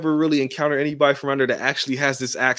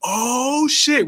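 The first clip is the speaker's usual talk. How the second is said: group exclaim; 9 semitones higher than usual. Retold or imitated. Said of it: "they all go".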